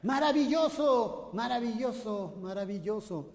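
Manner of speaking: triumphant call with dying echo; shout then echo. Retold or imitated.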